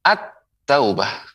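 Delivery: slow "at-taubah", l melted into a double t; merged article. Shown as a demonstration. Quran teacher confirms the right pronunciation, merged article. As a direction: linger on the t in "at-taubah". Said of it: "Begitu pengucapannya".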